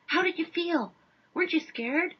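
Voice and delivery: high, pleading questions; mock desperate newsman fishing for a quote; desperate reporter. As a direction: beg the witness for some emotion.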